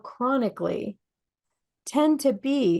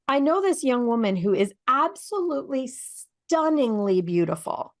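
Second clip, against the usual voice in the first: ordinary delivery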